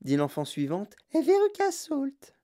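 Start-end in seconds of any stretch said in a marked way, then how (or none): 1.1-2.3 put-on very high, slow little girl voice; high-pitched girl introducing herself; Veruca Salt. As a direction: say it slowly in a tiny high child's voice. Next child introduces herself.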